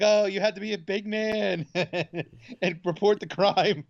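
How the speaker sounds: slow, sing-song teasing, high; a wife teasing her husband with a laugh; mocking wife. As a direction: drag it out to needle him. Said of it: "She's like".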